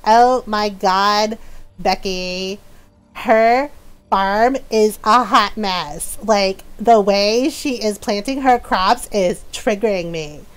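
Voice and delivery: slow, sulky put-on whine of a backseat viewer; mock critic voice. Mocking a chat critic, slow sulky whine. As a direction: drag it out like a fussy know-it-all. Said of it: "that voice".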